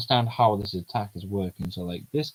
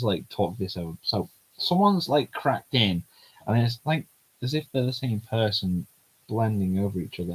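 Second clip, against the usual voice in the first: ordinary delivery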